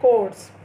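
कोर्स as a standalone model word, p left out; silent p. Shown as a demonstration. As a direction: say कोर्स clearly, without any p. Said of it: "कोर्स word ही इसको पढ़ना है".

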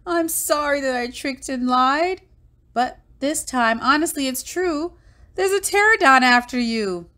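high, insistent little dinosaur voice; high-voiced earnest warning; pleading Bonehead. A narrator's reading.

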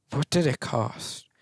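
quiet dramatic echo. Shown as a demonstration. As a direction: let it fall away softly like a movie line. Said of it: "But what did it cost?".

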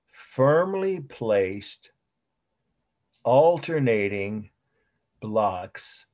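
very slow, phrase by phrase with long pauses; drawn-out words, wide gaps between chunks; slow dictation. Shown as a demonstration. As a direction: dictate for students writing it down. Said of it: "I will say it slowly and repeat it".

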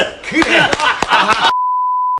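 high bright laugh; excited laugh. Real, not acted. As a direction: laugh out in delight. Laugh after the draw.

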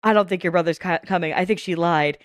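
a hint of a worried character; voicing the doubter. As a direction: read the doubter's line low and half-acted. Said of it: "they built it up like".

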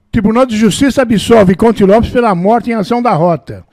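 put-on reading his old newspaper acquittal aloud like a news report. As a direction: read the clipping like a news anchor. Reads newspaper clipping aloud.

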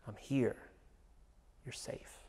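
soft, hushed reassurance; calm her back to sleep softly; soothing whisper. Retold or imitated. Parent hushing a frightened daughter, soft and tender. Repeating comfort phrase to daughter.

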